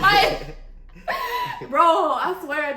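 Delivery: high loud laughing, slowed. Real, not acted. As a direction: crack up mid-sentence.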